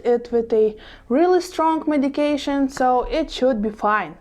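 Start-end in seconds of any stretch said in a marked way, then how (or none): none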